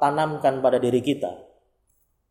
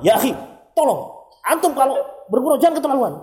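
higher, louder, fast heated reading; preacher shows how an angry reader hears the chat: higher, faster, heated; emotional reading; 10 semitones higher, 4 dB louder, faster than usual. Shown as a demonstration. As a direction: read the same message as if it were an insult. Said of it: "kalau kita bacanya emosional".

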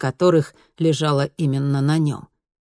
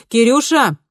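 loud call across the flat; 8 semitones higher, 7 dB louder than usual. A narrator's reading. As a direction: call the boy from the kitchen. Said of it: "позвала мама".

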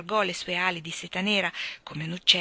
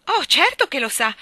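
louder, higher eager interjection; rash blurt; 8 semitones higher, 10 dB louder than usual. A narrator's reading. Michael answering Mrs Banks for Mary, rash eager blurt. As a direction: blurt it out before thinking. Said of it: "rispose Michael imprudentemente".

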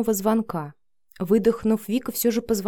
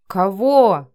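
loud, indignant retort; matching her heated tone; 7 dB louder than usual. A narrator's reading. Matvey answering Vika's accusation, just as heated. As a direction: fire the question straight back at full volume. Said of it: "в тон ей ответил Матвей".

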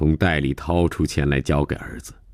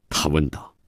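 ordinary delivery

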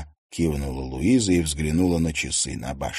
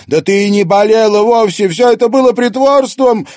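much higher and louder, shouting; furious accusation; 10 dB louder than usual. Acted. Daughter yelling at her mother for faking illness. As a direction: hurl the accusation at full volume.

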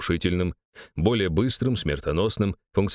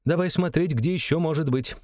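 Irina voice; 8 semitones higher than usual. A narrator's reading. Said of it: "начала искать адрес".

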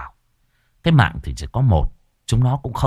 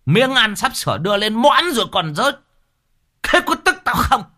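furious outburst; 11 semitones higher than usual. A narrator's reading. Master Chánh raging at his son Trần, furious. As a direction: spit the insult and rant. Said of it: "Lão Chánh trợn mắt".

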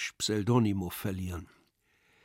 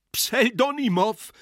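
high, loud, slow cry for help; shouted name; 12 semitones higher, 6 dB louder than usual. Acted. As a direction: call out in outraged alarm. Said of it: "schrie Iwan Iljitsch". (empörter Ausruf, laut und hoch)

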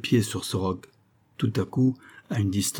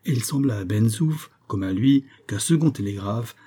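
ordinary delivery, nothing marked

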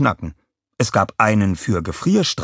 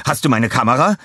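excited; 6 semitones higher, 5 dB louder, faster than usual. A narrator's reading. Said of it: "rief er aufgeregt".

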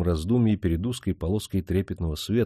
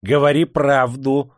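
louder, slow furious demand; angry shout; 5 semitones higher, 6 dB louder, slower than usual. A narrator's reading. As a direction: slam the words down. Interrogator shouts at prisoner.

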